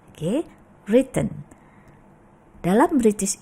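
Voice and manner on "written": WRITTEN as RIT-ten; silent w. Shown as a demonstration. Teacher repeats the right reading, correct pronunciation. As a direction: say WRITTEN without the w. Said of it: "yang betul adalah written".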